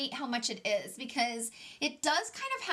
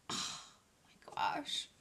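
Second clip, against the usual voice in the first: whispered oh my gosh; breathy gasp; 7 dB quieter, much slower than usual. Real, not acted. Gushing over lip gloss.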